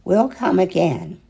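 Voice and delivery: plain reading voice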